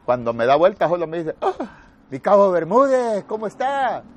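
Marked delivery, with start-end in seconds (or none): 1.4-4.0 surprised recognition. Retold or imitated. Soldier recognizes his old corporal.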